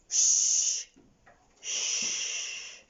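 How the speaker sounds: a long hissed "Sh" with no voice; isolated sh sound. Shown as a demonstration. Teacher modelling the phonics sound, a long hiss. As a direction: hold out "Sh" as a hiss. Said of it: "Can you say that sound for me?".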